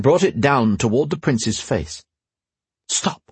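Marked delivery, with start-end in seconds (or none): 2.9-3.2 whisper. Acted. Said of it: "the word was naught but a whisper".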